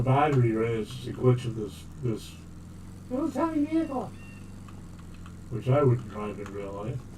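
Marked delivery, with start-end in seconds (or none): 3.1-4.1 much higher, quick silly voice; cutesy mock voice. Mocking his tiny game car in a cute squeak. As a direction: say it like it's adorable.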